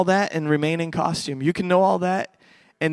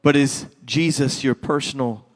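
soft, low altar call; prayer invitation; 5 semitones lower, 6 dB quieter than usual. Acted. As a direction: quietly draw them into prayer.

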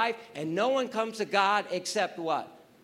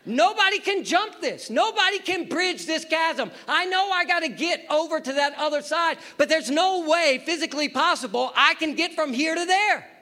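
high, raised voice of disbelief; exasperated protest; 9 semitones higher, 5 dB louder than usual. Acted. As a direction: protest loudly that it cannot be done. Acts Indiana Jones at the chasm.

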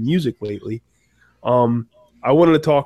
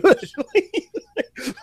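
squeaky imitated laugh; high goofy laugh. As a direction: pitch the laugh way up.

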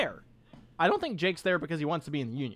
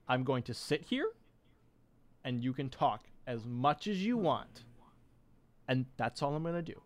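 quiet, gentle promise to listen; soft reassuring; 2 semitones lower, 8 dB quieter than usual. Retold or imitated. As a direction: soothe a friend in trouble, softly. Ben calmly offering support.

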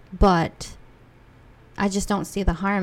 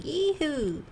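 imitating a sing-song yoo hoo; 9 semitones higher than usual. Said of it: "Jerome keeps saying".

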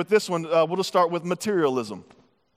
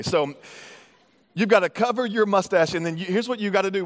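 normal talking voice

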